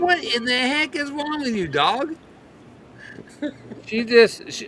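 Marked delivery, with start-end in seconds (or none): 0.0-2.1 pitched-up, mock exasperated scolding; exasperated owner. Owner scolding the yapping dog, mock exasperated. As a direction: scold the dog, amused more than angry. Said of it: "It's like".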